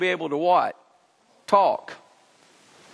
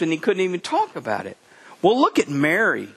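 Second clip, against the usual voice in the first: ordinary talk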